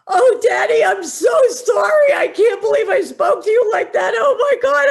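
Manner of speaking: put-on very high, loud, gushing teen apology. Daughter rushing out to apologize to her dad, high and loud. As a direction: squeal it out, overflowing with remorse. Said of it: "she comes out and she goes".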